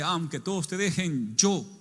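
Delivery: mock bravado of a sure follower; cocky confidence. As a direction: toss it off full of self-assurance. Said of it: "La otra vez bien campante".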